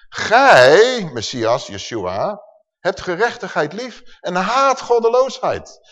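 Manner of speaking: emphatic psalm reading with loud bursts; scripture declamation. Acted. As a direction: proclaim it so it sinks in, stretching key words.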